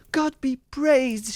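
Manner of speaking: high, loud, drawn-out exclamation; relieved cry. A narrator's reading. Sister sighting the rescuers, high exultant cry. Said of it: "she cried presently".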